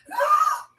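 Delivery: sudden high yelp; startled high yelp; sudden shriek. Real, not acted. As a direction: blurt out a sharp high cry. Sudden outburst mid-talk.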